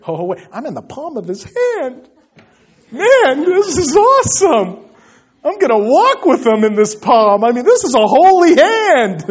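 high, loud, exultant outburst; awestruck excitement. Acted. Believer realizes he's in the palm of his hand, awestruck. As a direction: build from sudden realization to loud, high amazement. Acting out awed realization, voice soaring.

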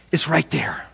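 soft, low storytelling whisper; hushed whisper. Retold or imitated. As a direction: drop into a whisper, as if sharing her secret. Woman's hushed sense of Jesus near.

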